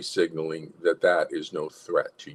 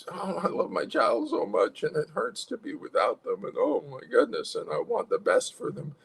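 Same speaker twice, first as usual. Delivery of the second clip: high, plaintive pleading; sad, loving parent; 10 semitones higher, 3 dB quieter than usual. Acted. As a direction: plead softly for nurture, acted. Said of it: "the sadness".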